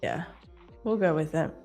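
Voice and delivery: normal talking voice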